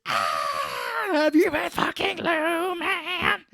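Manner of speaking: high, slow crying shout; sob-yell it like a jealous child; hammed-up weeping rage; wailing accusation.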